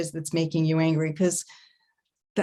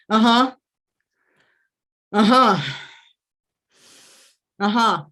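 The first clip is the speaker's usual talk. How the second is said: loud, punchy "uh-huh"; loud huddle grunt; 3 semitones higher, 6 dB louder than usual. Acted. Player psyching up after a long day, loud uh-huhs. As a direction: grunt it out big, spaced apart.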